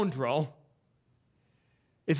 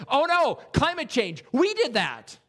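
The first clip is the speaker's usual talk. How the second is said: mock alarm; 8 semitones higher, 4 dB louder than usual. Mock alarmed reaction to storm.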